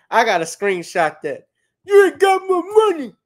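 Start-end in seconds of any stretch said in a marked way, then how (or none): 1.9-3.1 high, loud squeaky mock rant; hammed-up outraged lawyer demanding pay; mock angry lawyer voice. Watching a meme, voicing the angry lawyer: high mock rant.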